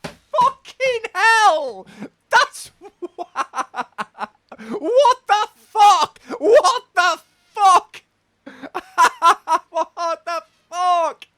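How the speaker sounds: high, loud, slow laughing what the fuck; cracking up, can barely get it out; laughing in disbelief. Real, not acted. Laughing hard at a crude yogurt rifle pickup line.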